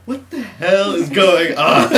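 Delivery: loud, high, drawn-out mock outburst; exasperated yell. Baffled cop to the boys, exasperated yelling. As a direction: stretch it out, imitating a cop at wit's end.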